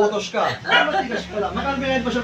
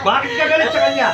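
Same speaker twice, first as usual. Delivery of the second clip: loud, stern questioning; forceful demand; 8 dB louder than usual. Real, not acted. Healer questions the spirit's anger, forceful demand. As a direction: press hard for an answer.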